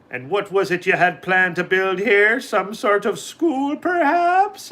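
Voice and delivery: slow, rising to a high leprechaun squeak; sly little man teasing; mocking leprechaun. A narrator's reading. Patrick mockingly guesses a school, slow and rising high. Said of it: "asked Patrick".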